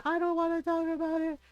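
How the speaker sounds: high, mock-tearful refusal; retold whine through tears, lightly acted; crying protest. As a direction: imitate an upset kid shutting down. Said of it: "I was crying and I said".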